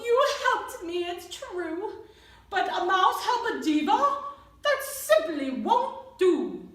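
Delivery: bellowing diva. Acted. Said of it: "She bellowed and bawled".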